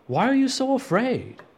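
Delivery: high Jesus rebuke. Acted. Jesus voiced rebuking disciples.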